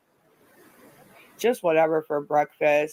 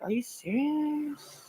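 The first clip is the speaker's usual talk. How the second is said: incredulous; 9 semitones higher, 9 dB quieter, slower than usual. Retold or imitated. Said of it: "they're like".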